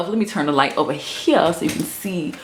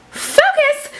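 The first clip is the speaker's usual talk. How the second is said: much higher squeak on focus; high comic yelp; squeaky focus. Real, not acted. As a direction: squeak it to snap back to task. Calls self back on track.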